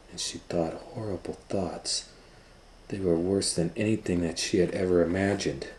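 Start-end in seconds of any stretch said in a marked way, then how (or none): none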